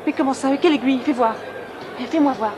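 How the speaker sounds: loud, urgent questioning; alarmed demand. Acted. Alarm on hearing of a needle.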